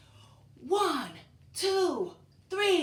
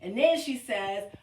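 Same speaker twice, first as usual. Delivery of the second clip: normal talking voice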